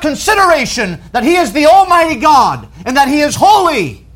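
high, loud, impassioned preaching; forceful preaching. Real, not acted. Preacher warning against flippant use of God's name, forceful preaching.

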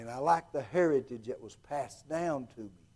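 ordinary talk, nothing marked

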